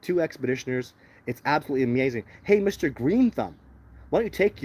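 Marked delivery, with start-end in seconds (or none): 2.4-4.6 mocking call-out. Real, not acted. Taunting a commenter again.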